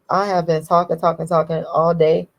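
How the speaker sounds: normal talking voice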